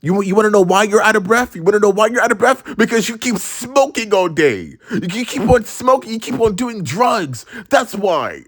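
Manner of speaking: high, rapid-fire mock-mom grilling; accusing mom. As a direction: imitate a mother pouncing with accusations. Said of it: "And she was like".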